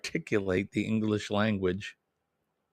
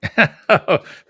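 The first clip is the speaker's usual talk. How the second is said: a loud high burst of laughter; 10 semitones higher, 12 dB louder than usual. Real, not acted. Host laughing loudly and high at his own Greek joke.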